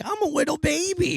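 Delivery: put-on high baby voice; little baby voice. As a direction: squeak it like a tiny child. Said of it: "I do a voice".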